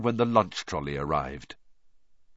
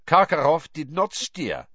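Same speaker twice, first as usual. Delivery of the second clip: gruff voice; 7 semitones higher, 4 dB louder than usual. Acted. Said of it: "said a gruff voice".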